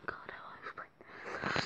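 whispered aside under the breath; whispering. Real, not acted. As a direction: whisper it to yourself. Muttered aside while explaining.

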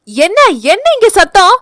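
high, loud demanding elder woman; march in and demand; stern elder. Acted. Mother-in-law arriving at noise. (சத்தம் கேட்டு வரும் மாமியார்)

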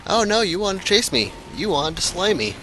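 sing-song gloating tease; high, taunting the fallen foe; mocking taunt. Real, not acted. Taunting a killed enemy.

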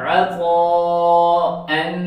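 अतअन drawn out very slowly, throaty ain; slow ain demo. Shown as a demonstration. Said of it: "ऐसे जी". (आइन के साथ धीरे धीरे अतअन)

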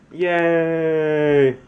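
high excited yay; excited cheer. Real, not acted. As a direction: cheer at finally getting it right. Dictation finally gets it right.

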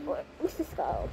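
whisper. Real, not acted. Searching for toy.

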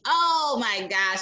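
ordinary delivery, nothing marked

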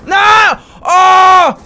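sharp high yelling gasp of surprise; startled yelp. Real, not acted. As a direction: yell out as the shot nearly lands. Sudden close call in game.

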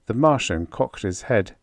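plain reading voice

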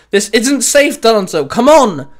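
loud, high urgent call. Acted. Winston urges Danzo away from the exploding ground, calling out. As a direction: raise the voice and plead. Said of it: "Winston called out".

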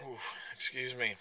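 ordinary talk

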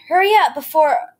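loud, high panicked cry cut short; urgent cry that chokes off; sharp frightened warning, loud and high; panicked plea. A narrator's reading. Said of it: "my mother's voice blocked".

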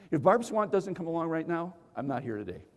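ordinary delivery

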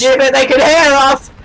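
high, loud, drawn-out warning; mock-alarmed shout; urgent yell. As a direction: call out as if discovered. Calling to friend mid-game.

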